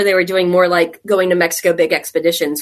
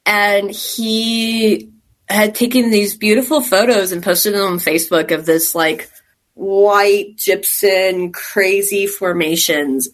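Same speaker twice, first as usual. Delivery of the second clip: ordinary talk, nothing marked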